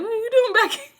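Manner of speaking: high, loud quote breaking into laughter; laughing retelling. Retold or imitated. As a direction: crack up while imitating the startled question. Retelling a quote while cracking up.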